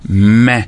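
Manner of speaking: "main" said as a full, long mè; separated syllable. Shown as a demonstration. Teacher finishing the split of madame, last syllable fully voiced. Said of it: "Vous avez ouvert la bouche trois fois".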